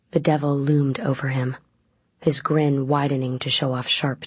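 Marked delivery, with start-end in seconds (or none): none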